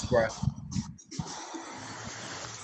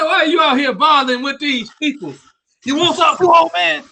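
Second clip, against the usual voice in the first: higher, rising into a mock shout on old man; imitating the old man yelling; 8 semitones higher than usual. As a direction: ham up the angry old man shooing people off. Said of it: "He said".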